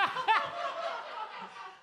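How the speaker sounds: high-pitched laughing fit. Real, not acted. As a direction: burst out laughing. Laughs at co-host's joke.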